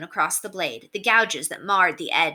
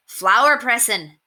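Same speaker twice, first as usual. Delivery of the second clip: slow, louder dry sarcasm; sarcastic; 5 semitones higher, 6 dB louder, slower than usual. A narrator's reading. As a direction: drip sarcasm on it. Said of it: "he said sarcastically".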